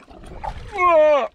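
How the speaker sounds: sudden high-pitched whoa; excited whoop. Real, not acted. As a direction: yell it as the fish takes. Fish hooked on count of one.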